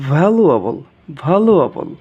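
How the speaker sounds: "ভ্যালুয়েবল" with a teeth-on-lip v; demonstrated V sound. Shown as a demonstration. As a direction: buzz the first v of "ভ্যালুয়েবল". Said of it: "উপরের দাঁত নিচের ঠোঁট". ("ভ্যালুয়েবল" দাঁত-ঠোঁটের ভ দিয়ে)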